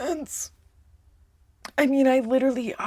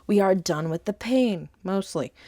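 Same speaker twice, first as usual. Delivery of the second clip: usual speaking voice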